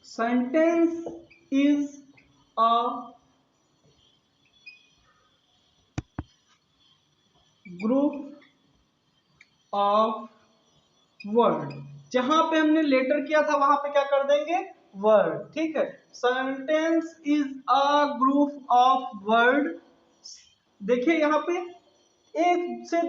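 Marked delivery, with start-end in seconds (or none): 0.0-11.7 one word at a time, long pauses; halting word-by-word teacher dictation; slow dictation. Shown as a demonstration. Dictating definition while writing it. 16.1-19.7 measured word-by-word definition; drawn-out, deliberate teaching delivery; slow recitation. Shown as a demonstration.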